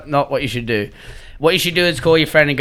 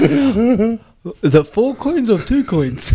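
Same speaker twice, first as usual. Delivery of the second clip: much higher, very slow, puzzled mocking voice; teasing the New Zealanders, mock confusion; mock dim bloke; 9 semitones higher, much slower than usual. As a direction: play the slow-witted gambler. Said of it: "They're like".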